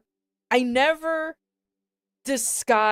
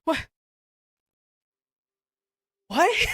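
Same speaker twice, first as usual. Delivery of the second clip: higher, louder incredulous shout; shocked what; 8 semitones higher, 4 dB louder than usual. Real, not acted. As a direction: yell it in disbelief. Disbelief at his reason.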